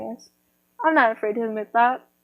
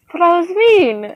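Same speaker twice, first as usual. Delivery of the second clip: higher, louder mock protest; guilty protest; 7 semitones higher, 5 dB louder than usual. Retelling a reply to a nice commenter, guiltily protesting. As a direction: protest your own meanness, pitched up.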